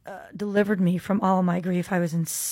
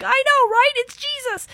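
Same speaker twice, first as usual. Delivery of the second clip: very high-pitched excited squeal; hammed-up, giddy high voice; gleeful squeal; 4 dB louder than usual. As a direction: squeal it like thrilling news, way up high. Acts out her gleeful reply to chaplains.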